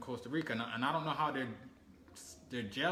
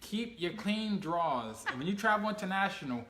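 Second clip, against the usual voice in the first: put-on lecturing the kids; 4 semitones higher, 4 dB louder than usual. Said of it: "Like I told my kids".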